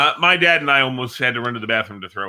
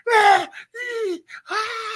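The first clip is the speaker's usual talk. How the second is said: high strained yelling grunts; imitated lifter roar; much slower than usual. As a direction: roar like a powerlifter under the bar. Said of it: "He comes up, gets underneath it".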